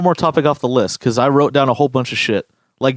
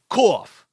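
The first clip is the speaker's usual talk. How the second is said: the word cough barked high like a real cough; a kid's mock cough, blurted out; fake cough word; 8 semitones higher than usual. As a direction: imitate a classmate faking a cough. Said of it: "say it like he was coughing".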